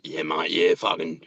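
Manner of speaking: a hint of a rough tradie; Mike Nolan. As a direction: do the construction-site bloke. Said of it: "like Mike Nolan".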